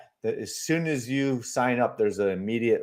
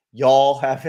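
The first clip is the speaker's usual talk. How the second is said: a playful, louder touch of Southern drawl; Southern accent; 8 dB louder than usual. Pretend. Streamer teasing a viewer, Colson, with a put-on Southern accent. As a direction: lean lightly into a Southern accent. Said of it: "I did that Southern accent for you".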